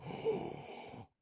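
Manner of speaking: long, airy intake of breath; astonished gasp. Acted. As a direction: gasp in awe at a gift. Mother sees what the gift is.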